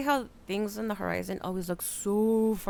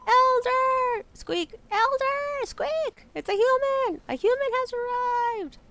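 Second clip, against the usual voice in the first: much higher, loud squealing alarm; excited little rat; 14 semitones higher, 5 dB louder, slower than usual. Acted. Small rat shouting for the Elder, shrill excitement. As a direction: squeal it like a panicked tiny rat.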